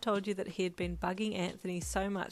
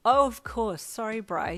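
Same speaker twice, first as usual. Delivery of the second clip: raised, quick-to-agree apology; apologetic; 4 semitones higher, 10 dB louder than usual. A narrator's reading. Apologising to a friend over his puppy, apologetic. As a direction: apologise brightly and loudly. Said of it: "you said apologetically".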